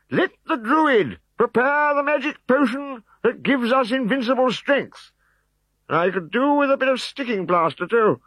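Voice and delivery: a pompous chief bossing his bearers; high loud pompous chief; fussy old chief. A narrator's reading. Chief voiced.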